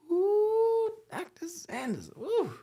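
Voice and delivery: very high, loud drawn-out moan to the Lord; woman in labour praying. Retold or imitated. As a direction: cry out slowly to Jesus mid-contraction. Second labour imitation.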